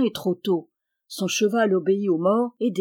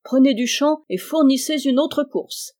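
higher and louder rallying call; exclaiming; 6 semitones higher, 4 dB louder than usual. A narrator's reading. Man-at-arms urges Aubry to run another course, loud exclaim. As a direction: rouse the young knight to go again. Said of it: "s'écria l'homme d'armes".

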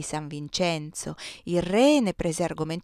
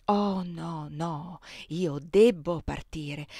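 regretful refusal; much slower than usual. A narrator's reading. Feigned sorrowful refusal in the comedy.

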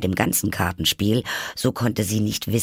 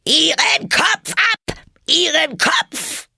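high, drawn-out enraged bellow; furious roar; 6 dB louder, slower than usual. A narrator's reading. Queen red with rage ordering a beheading, roaring. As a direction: roar the order at full pitch. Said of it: "fing sie an zu brüllen".

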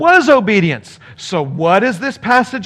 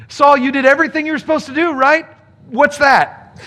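higher, fast mocking interrogation; sarcastic Samuel; 7 semitones higher than usual. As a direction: needle him with fake innocence. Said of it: "But Samuel said".

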